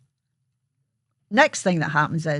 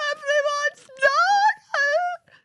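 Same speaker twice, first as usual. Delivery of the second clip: horrified wail; much slower than usual. Retold or imitated. Child wailing at the news.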